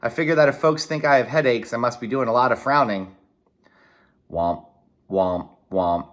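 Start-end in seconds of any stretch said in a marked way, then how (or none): 4.3-6.1 low thudding womp womp; heartbeat. Retold or imitated. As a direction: mimic a heavy heartbeat. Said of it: "That's my heart".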